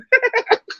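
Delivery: sharp high burst of laughter; a real high cackle; high giggle at himself. Real, not acted. Laughing at being old.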